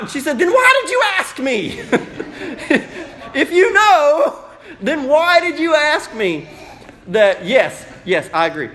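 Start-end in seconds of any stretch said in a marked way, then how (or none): 0.4-6.5 high, loud mock-exasperated retort, laughing through it; exasperated laughing mama. Mama scolding her little son, laughing exasperation. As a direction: throw it back at the kid, cracking up.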